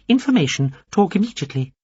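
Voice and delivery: a small alien addressing Veppers carefully; high alien voice. A narrator's reading. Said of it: "the little alien said".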